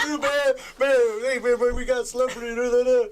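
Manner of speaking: high, loud imitated babble; starstruck excitement. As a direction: gush about meeting a celebrity. Prisoner thrilled to meet a celebrity.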